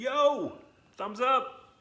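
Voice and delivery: high, loud, eager "yo, thumbs up"; excited kid voice. Pretend. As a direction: play the keen student asking to share. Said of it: "you're gonna say".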